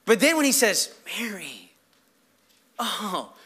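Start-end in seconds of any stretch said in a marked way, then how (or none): 1.0-1.7 very soft, low call; quiet, intimate, gentle address; tender naming. Acted. Jesus gently calls her name. 2.8-3.3 soft, low sigh of realization; acted quiet awe; hushed recognition. Mary's acted moment of recognition.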